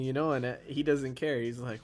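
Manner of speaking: usual speaking voice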